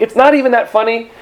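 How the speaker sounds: ordinary talk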